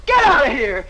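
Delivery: loud barked order; angry shout. Acted. Kitty shouting at the suitor to leave, loud. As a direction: throw him out.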